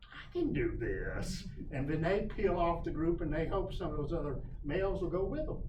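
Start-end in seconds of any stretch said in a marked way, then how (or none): 0.0-1.5 slow, lower suitor's line; lower, drawn-out quote; bird voiced as suitor. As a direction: draw it out like a determined little suitor. Voices a courting male bird.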